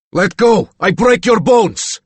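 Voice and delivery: loud, high, slow Slavic threat; furious threat. A narrator's reading. Reagan threatens Roop while they grapple, furious and loud. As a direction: spit out each word as a threat.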